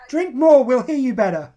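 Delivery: loud joking call; loud teasing. Real, not acted. Teasing, much louder.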